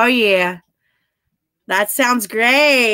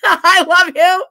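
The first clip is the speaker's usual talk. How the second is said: laughing; 7 semitones higher, 7 dB louder, slower than usual. Real, not acted. Laughing at a sweet chat message.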